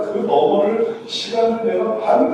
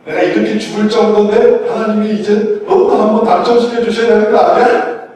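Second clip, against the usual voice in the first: loud, raised, entitled complaint; indignant demand; 3 semitones higher, 6 dB louder than usual. Acted. Believer testing God, indignant demand. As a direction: demand it loudly, as if owed.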